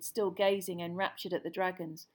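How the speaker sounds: ordinary delivery, nothing marked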